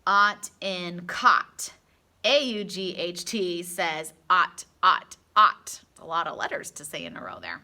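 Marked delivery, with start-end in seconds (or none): 0.1-5.8 ought in caught, loud and slow; loud, slow ought for the class; ought sound. Shown as a demonstration. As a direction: stretch out ought. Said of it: "So say this one with me".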